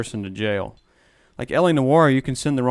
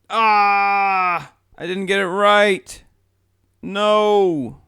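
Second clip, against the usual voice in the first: higher, louder cry of defeat; frustrated outburst; 6 semitones higher, 3 dB louder, much slower than usual. Real, not acted. Player yelling at his wrong verdict, frustrated.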